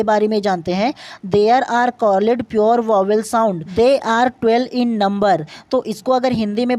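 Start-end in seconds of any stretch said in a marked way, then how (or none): 1.3-3.6 slow dictation. Shown as a demonstration. First reading of the definition. 3.8-5.4 slow dictation. Shown as a demonstration. Reading the English definition aloud.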